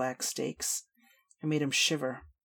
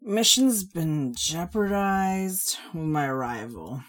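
loud, high, drawling tipsy rant; slow boozy complaining; brash drunk woman; 3 semitones higher, 4 dB louder, slower than usual. Acted. Shal again.

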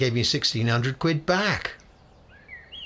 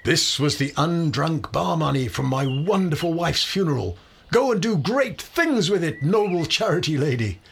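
grand pompous proclamation; 6 semitones higher than usual. Pretend. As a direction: declaim it like a grand benefactor. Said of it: "Perhaps I should have said".

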